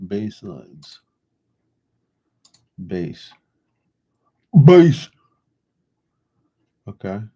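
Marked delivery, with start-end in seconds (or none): 4.6-5.1 loud shout. Real, not acted. Calling out [name] while looking for a bass line, shouted. Repeating a word while making beats.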